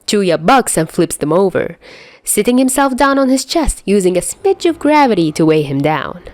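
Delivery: ordinary delivery, nothing marked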